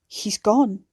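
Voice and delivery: gasped out in grief; forced out on a gasp. Acted.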